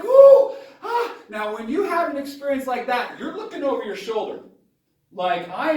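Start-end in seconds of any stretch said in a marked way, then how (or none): 0.0-1.1 high, loud, hammed-up panic yelps; breathless high-pitched whooping; panicked hollering. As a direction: yelp like a man who just fled a monster. Panicked dad again.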